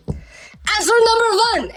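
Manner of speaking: put-on very high, drawn-out Russian-accented yell; slow, high shout in a Russian accent; angry Russian teacher. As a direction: imitate an exasperated Russian teacher's outburst. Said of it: "the teacher got really angry".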